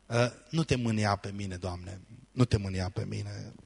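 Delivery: hushed plea. Retold or imitated. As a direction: murmur it gently to God, taking your time. Gideon's cry, hushed and slow.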